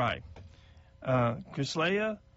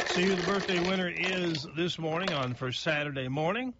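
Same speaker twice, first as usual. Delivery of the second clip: ordinary talk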